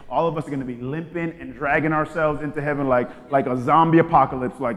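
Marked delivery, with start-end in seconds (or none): none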